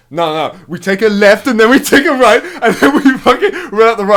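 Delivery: loud, high laughing left-right directions; hammed-up directions. Mocking the taxi guy's route in the car. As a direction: boom the directions through laughter. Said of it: "he's like".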